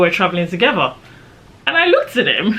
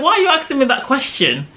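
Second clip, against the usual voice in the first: high-pitched, affronted question; indignant challenge; 8 semitones higher than usual. Retold or imitated. Traveller questioning the immigration officer's right to ask, indignant challenge. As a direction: challenge him with a raised pitch.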